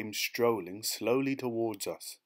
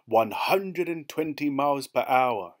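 loud, slow, higher-pitched bark; 5 semitones higher, 7 dB louder than usual. A narrator's reading. Policeman barking the speed at the driver, loud and slow. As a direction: bark it out word by word in outrage. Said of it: "he barked".

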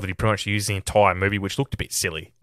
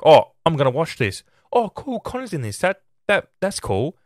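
higher, half-hearted gush of a casual fan; mock excited moviegoer; 7 semitones higher than usual. Reviewer mimics a casual moviegoer, higher, mock-excited. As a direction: mimic a moviegoer perking up at the name. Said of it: "people like".

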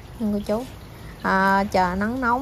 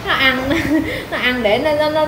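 talking through real giggles; laughing; 5 semitones higher, 4 dB louder than usual. Real, not acted. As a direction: let laughter break up the words, higher voice. Amused by the dog's stress eating. (vừa nói vừa cười khúc khích)

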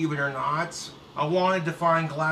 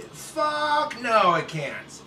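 emphatic denial; 6 semitones higher, much slower than usual. Real, not acted. Emphatic answer.

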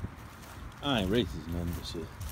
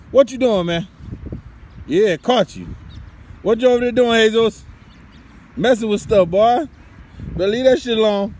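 loud, high teasing shout; calling out scolding; 12 semitones higher, 8 dB louder than usual. Real, not acted. Yelling at the kid caught messing with stuff, loud and high. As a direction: shout over to catch him messing around.

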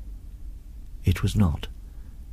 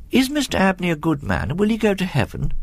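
put-on higher-pitched, curious child voice; sudden boyish question, pitched up; earnest boy; 8 semitones higher than usual. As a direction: blurt out an innocent question. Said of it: "he suddenly asked".

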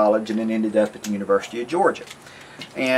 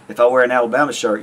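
normal talking voice

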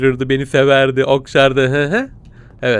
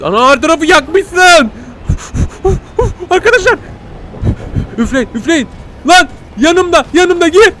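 high, loud panicked cries; 6 dB louder than usual. Real, not acted. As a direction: yelp in panic as it closes in. Panicking while hunted.

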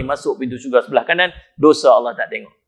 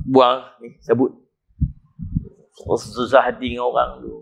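usual speaking voice

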